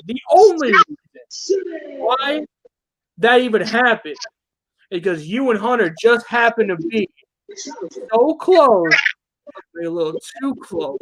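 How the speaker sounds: ordinary delivery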